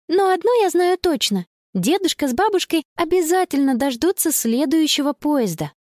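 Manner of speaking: put-on high-pitched, assured girl's voice; confident girl. A narrator's reading. Girl reassuring friends that grandparents will wait, confident. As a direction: lift the pitch, sound sure.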